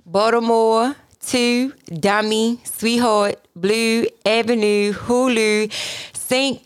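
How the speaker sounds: slow words in Baltimore accent. Shown as a demonstration. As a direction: read the list in Baltimore accent. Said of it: "Jazz doing the, the Baltimore accent challenge".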